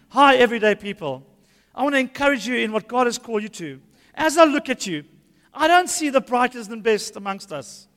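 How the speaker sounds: a hint of a cheery pastor; mock Paul greeting. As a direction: play Paul cheerfully insulting the church. Imagined Paul greets church.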